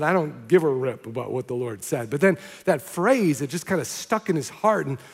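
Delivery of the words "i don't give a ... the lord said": a touch of scornful grumble, lower; dismissive curse. Retold or imitated. The man throws the paper away, mildly scornful. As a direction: toss it away muttering. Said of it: "threw it in the trash with a curse".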